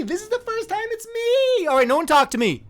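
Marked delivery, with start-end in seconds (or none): 0.0-1.7 much higher, squeaky repeat of "me"; squeaky excited. Real, not acted. Streamer squealing to chat at finally being picked. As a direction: squeal it with delight.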